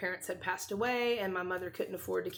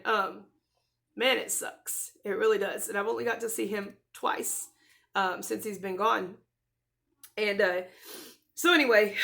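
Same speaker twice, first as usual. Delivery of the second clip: usual speaking voice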